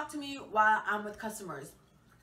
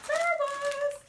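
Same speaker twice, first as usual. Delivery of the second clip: excited squeal; 5 dB louder, slower than usual. Real, not acted. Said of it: "Guys, wait. I have chips".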